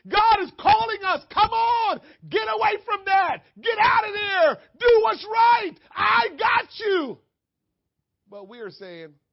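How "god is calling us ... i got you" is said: much higher and louder, slow and urgent; full-force preaching shout. Real, not acted. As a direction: call them out of danger at full voice. Preacher shouting God's call.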